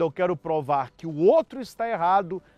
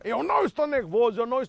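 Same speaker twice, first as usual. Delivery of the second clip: rushed, higher-pitched denial of anger; mock agitated denial; 5 semitones higher, much faster than usual. Spouse pounding the table denies being upset, mock agitated. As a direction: blurt it in a hurry, mock worked-up. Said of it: "a pessoa diz isso aos gritos".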